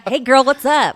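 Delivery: loud, high playful hello; mock chummy greeting. Joking she talks to her body, hammed-up chummy greeting.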